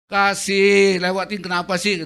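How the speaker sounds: high, loud angry snap; shouting at wife. Retold or imitated. Preacher arguing with wife in car, loud angry shout. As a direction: yell at her to let the car pass. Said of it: "saya sering berantem dengan istri".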